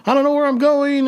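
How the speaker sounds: high, frantic mock yell; screaming at her. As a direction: call out to a stranger in a panic. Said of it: "was screaming at her".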